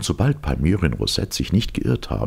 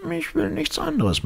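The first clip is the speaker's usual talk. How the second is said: very high professor voice; professor insisting. A narrator's reading. Professor insisting to the lieutenant, high voice.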